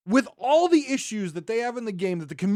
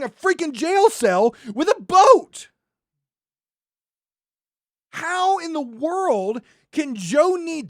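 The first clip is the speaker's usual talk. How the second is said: rising, louder exasperated rant; higher, louder furious tirade; angry rant; 6 semitones higher, 4 dB louder than usual. Real, not acted. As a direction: vent your fury at the devs, building. Ranting at the game's developers.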